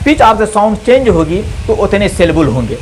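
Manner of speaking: usual speaking voice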